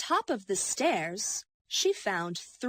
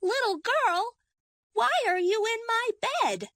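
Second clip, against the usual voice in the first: much higher, quick little cub voice; Baby Bear asking; 14 semitones higher, 3 dB louder than usual. A narrator's reading. Little bear wakes the girl in his bed, high and quick. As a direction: ask like a curious small bear. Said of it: "asked Baby Bear".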